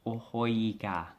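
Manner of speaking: O hoi ga said slowly in Cantonese tones; slow Cantonese O hoi ga, demonstrating; Cantonese demo. Shown as a demonstration. Teacher shows learners the Cantonese phrase, slow and careful. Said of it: "put it in context how you use it".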